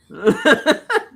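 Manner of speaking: loud high-pitched laugh; bright burst of laughter. Real, not acted. Laughing at own joke.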